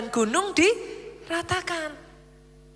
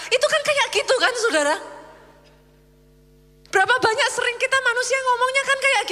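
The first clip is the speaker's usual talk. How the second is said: teasing rhetorical jab; 8 semitones higher, faster than usual. Real, not acted. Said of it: "teasing the congregation".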